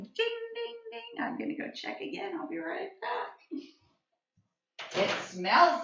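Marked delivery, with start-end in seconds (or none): 0.0-1.1 high, soft "ding, ding" like a timer; imitating the microwave's ding; microwave ding imitation. Shown as a demonstration. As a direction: chime like the microwave. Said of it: "the microwave sound going".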